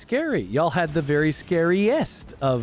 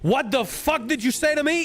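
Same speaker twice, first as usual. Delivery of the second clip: high-pitched mock outrage; acted-out indignant outburst; angry Polish guy; 9 semitones higher than usual. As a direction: imitate an offended man flaring up, pitch jumping. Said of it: "he was so fucking angry".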